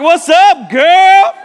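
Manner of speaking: very high, loud comic holler; hammed-up greeting shout. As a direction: holler it out with a rising finish. Voicing comic greeting to girl.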